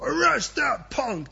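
high, drawn-out mock order to arrest; hammed-up outraged king giving orders; commanding king. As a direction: bellow it like an affronted ruler, half in jest. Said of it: "I think his tone has changed".

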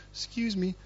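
high, softened mock-meek request; suddenly polite. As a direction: play the humbled king asking nicely. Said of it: "I think his tone has changed".

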